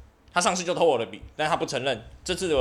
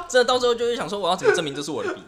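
laughing; 9 semitones higher, 6 dB louder, faster than usual. Real, not acted. Laughing, mock-anxious inner question.

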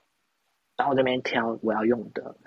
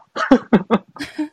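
high rising laugh, louder; real burst of laughter; 13 semitones higher, 6 dB louder than usual. Real, not acted. Laughing at himself after asking the salesman if his skin is that bad, loud high laugh.

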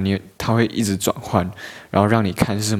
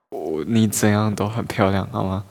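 ordinary talk, nothing marked

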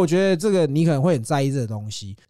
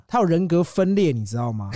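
ordinary talk, nothing marked

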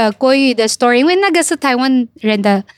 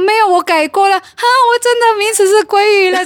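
put-on high, excited boy; bragging like a proud little boy; excited kid voice; 10 semitones higher than usual. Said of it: "他说".

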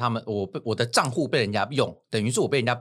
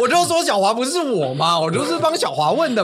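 higher, louder playful protest; excited protest; 9 semitones higher, 7 dB louder than usual. Real, not acted. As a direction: insist playfully, voice up high. Protesting while laughing.

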